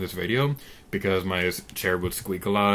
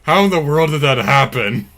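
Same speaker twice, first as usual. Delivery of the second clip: excited; 3 semitones higher, 9 dB louder than usual. Real, not acted. Amazed by a glitch.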